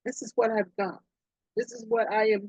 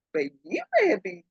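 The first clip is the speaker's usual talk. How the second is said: ordinary talk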